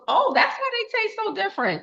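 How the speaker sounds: high, bright mock-son voice; son's remark. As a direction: pitch way up to play the teenage son. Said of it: "He goes".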